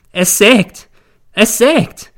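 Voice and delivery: high, loud wailing cry; a distraught mother wailing in hysteria. A narrator's reading. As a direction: wail it out in distraught panic. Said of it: "jammert sie".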